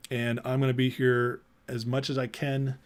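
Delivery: ordinary talk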